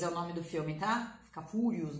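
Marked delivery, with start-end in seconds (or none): 1.4-2.0 "Furious" with a wrong "fu" opening; mispronunciation demo. Shown as a demonstration. Teacher warning students off "fu", "Furious" deliberately mispronounced. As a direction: say "Furious" starting "foo", low and soft. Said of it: "não, Furious".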